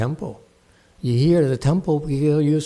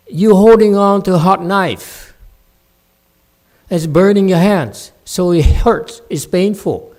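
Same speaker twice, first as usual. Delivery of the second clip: loud scolding lecture; loud stern master rebuking; 4 semitones higher, 9 dB louder than usual. Real, not acted. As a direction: berate the student, voice raised. Master scolding student loudly.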